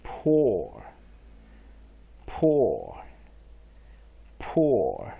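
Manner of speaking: "Poor" said low and loud with a falling tune, three times for repeating; drilled model word. Shown as a demonstration. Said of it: "let's go to the third vocabulary".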